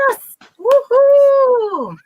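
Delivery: loud high woohoo; excited cheer. Real, not acted. Celebrating the win.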